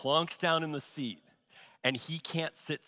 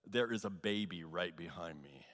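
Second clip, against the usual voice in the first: normal talking voice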